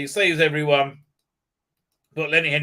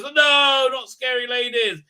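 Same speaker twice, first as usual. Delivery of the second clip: high, loud cry of dread; hammed-up panicked protest; mock horror; 9 semitones higher, 5 dB louder than usual. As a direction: wail it in pretend terror. Acts out horror at scary ladies.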